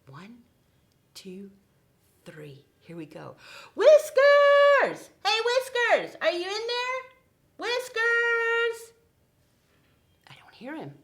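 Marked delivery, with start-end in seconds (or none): 3.7-8.9 high loud call; calling Whiskers out of his hiding place. Acted. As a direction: call him from far away. Calling out for the puppet.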